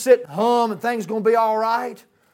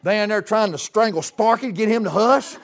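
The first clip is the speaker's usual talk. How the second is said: ordinary talk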